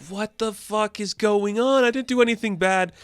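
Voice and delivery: baffled outburst. Retold or imitated. B reacts to Ice leaving with Rocky, in mock disbelief. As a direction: act stunned and defensive.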